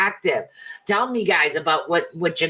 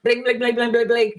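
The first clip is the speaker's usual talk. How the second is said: higher sing-song bling bling; high phone chime; 7 semitones higher than usual. Retold or imitated. Mimicking a phone notification, high sing-song chime.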